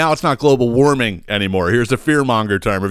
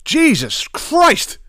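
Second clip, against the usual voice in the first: very slow, high exclamation; exasperated cry; much slower than usual. Real, not acted. Host fed up with yelling neighbors, slow high exasperated cry. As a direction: drag it out high in disbelief.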